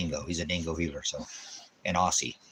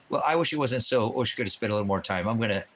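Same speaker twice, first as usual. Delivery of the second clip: usual speaking voice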